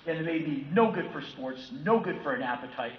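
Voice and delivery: normal talking voice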